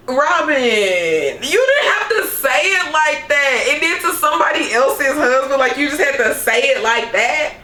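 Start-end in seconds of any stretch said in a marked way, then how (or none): 0.1-3.7 mock dismay. Acts out own shocked reaction to Robin.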